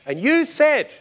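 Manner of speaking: a hint of an excited church lady; excited testimony. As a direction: lift it up like a thrilled witness.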